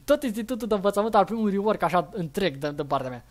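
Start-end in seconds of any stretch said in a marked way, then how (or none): none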